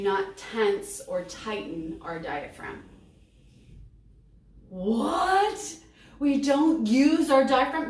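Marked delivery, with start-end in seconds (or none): 4.7-7.9 high, loud disbelieving outburst; mock shocked question. Teacher voicing a pupil shocked by the diaphragm rule, incredulous. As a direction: stretch it out, incredulous and rising.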